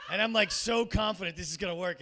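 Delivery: usual speaking voice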